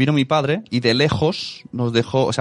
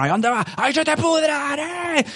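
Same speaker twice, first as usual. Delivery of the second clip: high, stretched angry yell at a shopper, slower; retold shout; slower than usual. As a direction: bark it at the man hoarding chickens. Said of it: "y le gritaron".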